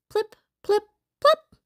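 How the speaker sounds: high, popping plip-plop; dropping treats. A narrator's reading. Treats drop into bags, high popping sounds.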